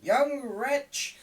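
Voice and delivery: high, loud scolding; scornful woman. A narrator's reading. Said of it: "said one woman".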